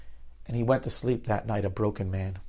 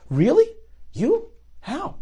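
amazed disbelief; 3 dB louder, slower than usual. Retold or imitated. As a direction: pitch jumps far higher, stunned and eager. Naftali amazed by the offer.

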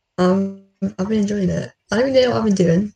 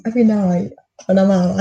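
usual speaking voice

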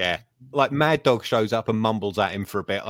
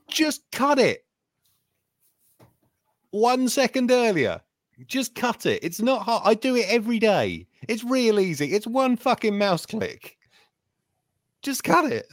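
much higher, pleading frustration; exasperated rant; 10 semitones higher than usual. Real, not acted. Complaining about the show's editing, high exasperated rant. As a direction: beg the editor to just cut it.

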